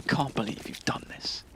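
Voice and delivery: hushed, whispered mock hurt; soft, breath-only imitation of disbelief. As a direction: whisper it like a wounded complaint. Mimics the meme line back.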